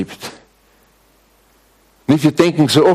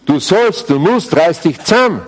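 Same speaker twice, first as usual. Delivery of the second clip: mock demanding law; 6 semitones higher than usual. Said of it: "dass man Gesetz hört".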